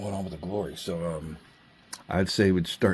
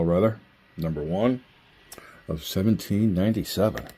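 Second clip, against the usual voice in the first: ordinary talk, nothing marked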